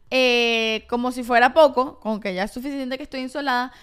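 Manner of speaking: normal talking voice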